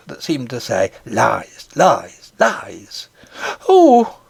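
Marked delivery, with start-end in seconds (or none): 1.0-3.1 low, indistinct voice repeating lies; an unseen goblin taunting from nearby; hoarse goblin whisper. A narrator's reading. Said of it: "a hoarse, indistinct voice". 3.7-4.2 high, loud frightened cry; a nurse's sudden cry of fear; near scream. A narrator's reading. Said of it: "a sigh that was almost a scream".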